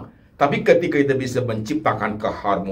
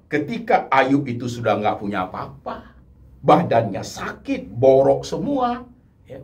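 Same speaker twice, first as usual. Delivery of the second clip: ordinary delivery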